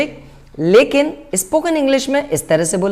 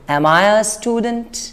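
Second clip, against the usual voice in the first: slow, rising question tune on Am I a student; much slower than usual. Shown as a demonstration. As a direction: ask it slowly, letting student rise. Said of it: "question tone में पूछो". (सवाल वाली tone में, धीरे से ऊपर जाती आवाज़)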